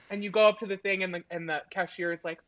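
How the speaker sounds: ordinary delivery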